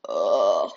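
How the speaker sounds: deep groggy grunt, coming round; a dazed, low groan. A narrator's reading. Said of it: "she grunted".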